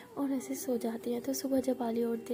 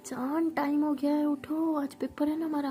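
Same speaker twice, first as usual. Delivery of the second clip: higher, louder wake-up call; waking call; 4 semitones higher, 4 dB louder than usual. Acted. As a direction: rouse her, a bit impatient. Aliya waking her partner loudly. (जगाते हुए ऊँची आवाज़ में)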